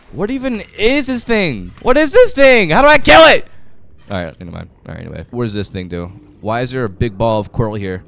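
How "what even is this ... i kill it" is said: panicky cries. Real, not acted. Panicked at a creature.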